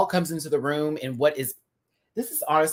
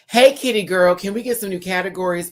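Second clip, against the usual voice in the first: mock plea; 5 semitones higher, 6 dB louder than usual.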